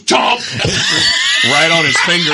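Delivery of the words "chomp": loud high chomp sound; mock snake bite. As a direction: snap it out like a biting snake. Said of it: "he goes".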